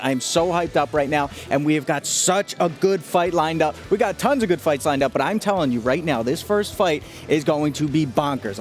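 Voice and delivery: barely holding in the hype; excited. Real, not acted. Co-host bursting with hype.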